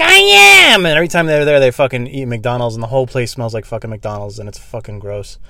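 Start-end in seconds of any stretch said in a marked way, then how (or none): 0.0-0.8 very high, loud mock snap back; hammed-up girlfriend yelling back; shrill girlfriend retort. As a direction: girlfriend snapping back at boyfriend. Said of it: "And she's like".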